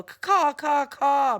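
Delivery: loud, high cawing like a crow. Acted. Crow swooping at the narrator, harsh loud cawing. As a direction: screech it out like a crow.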